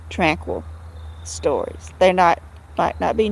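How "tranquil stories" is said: hushed. Real, not acted. Hushed aside about dark stories.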